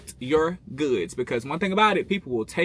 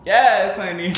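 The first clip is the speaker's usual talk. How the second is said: loud, high sassy yes; sassy yes honey; 7 semitones higher, 12 dB louder, slower than usual. Real, not acted. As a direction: belt it out with sass. Sassy exclamation.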